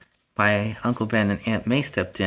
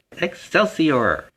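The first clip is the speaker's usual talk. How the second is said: big drawn-out sign-off cheer; rallying cry; 5 semitones higher, 4 dB louder, much slower than usual. Real, not acted. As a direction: stretch it out and call it loud.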